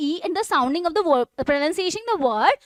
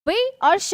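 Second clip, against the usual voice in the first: वी and श each said as its own beat; separate stressed parts; 4 semitones higher than usual. Shown as a demonstration. Teacher showing two stresses, वी and श separated. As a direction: stress वी, then श, keeping them apart. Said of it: "दो जगह पर हम stress".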